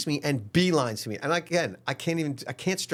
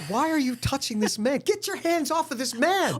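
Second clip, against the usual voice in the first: higher, incredulous telling-off; mock self-scolding; 14 semitones higher than usual. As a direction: snap at yourself in disbelief. Inner voice scolding himself.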